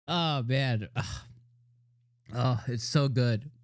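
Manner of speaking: usual speaking voice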